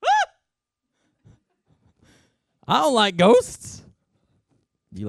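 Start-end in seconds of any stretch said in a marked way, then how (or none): 0.0-0.3 high-pitched mock scream; fearful cry. Disciples crying out at a ghost, high mock scream. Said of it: "in fear they cry out". 2.7-3.8 mock fear. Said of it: "own fear joke".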